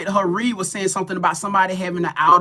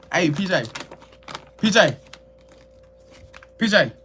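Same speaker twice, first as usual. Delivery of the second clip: calling out. Real, not acted. Calling someone in the house.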